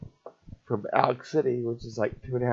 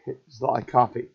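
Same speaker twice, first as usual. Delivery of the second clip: usual speaking voice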